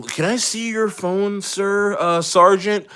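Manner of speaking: high put-on voice; mock official asking, in a high voice; imitated questioner. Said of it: "They were like".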